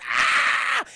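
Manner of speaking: high mock scream of terror; loud cartoon fright shriek; terrified scream. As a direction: imitate a little girl screaming. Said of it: "runs away in fucking terror".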